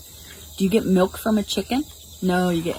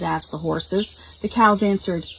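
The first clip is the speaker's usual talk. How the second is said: usual speaking voice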